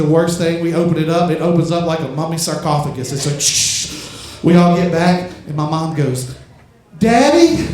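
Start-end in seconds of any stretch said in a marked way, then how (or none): 3.1-4.4 slow airy hiss like a sarcophagus opening; hissing freezer lid. Retold or imitated. Comic telling the crowd the freezer lid opening, slow hiss. 7.0-7.6 high, louder hopeful call into the freezer; mom calling out. Retold or imitated. Mom calling to her dead father in the freezer, high hopeful call.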